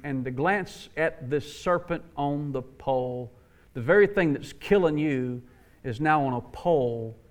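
low, soft, weighty sermon delivery; quiet, deliberate preacher voicing the gospel; hushed solemn preaching. Real, not acted. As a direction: bring it down low and let each phrase settle.

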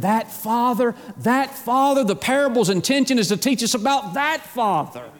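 high, emphatic pulpit climax; fervent preaching. Real, not acted. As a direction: lift the pitch and hammer the repeated phrase. Preaches at full force.

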